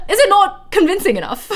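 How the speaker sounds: high, loud posh Brit mock indignation, giggling between; posh British accent. Streamer answering teasing about the accent, high posh British accent. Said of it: "seriously with this accent".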